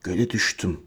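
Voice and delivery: very low, hushed words; soft and low, almost soundless; mouthed silently. A narrator's reading. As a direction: barely voice it, as if only mouthing. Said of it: "çıkarmadan dudaklarıyla".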